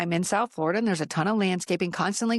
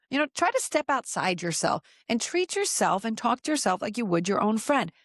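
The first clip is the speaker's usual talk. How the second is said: ordinary talk, nothing marked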